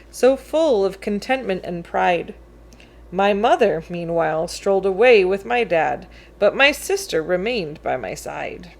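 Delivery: ordinary delivery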